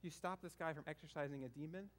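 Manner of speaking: mock eye roll. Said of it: "He's probably like".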